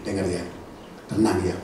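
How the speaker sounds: ordinary delivery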